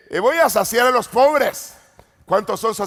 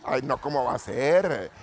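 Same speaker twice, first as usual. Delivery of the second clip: ordinary talk, nothing marked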